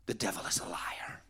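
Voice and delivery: low whispered warning; hushed, firm inner warning; another inner voice. Retold or imitated. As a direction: whisper it like a voice in the head. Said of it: "then I hear another voice".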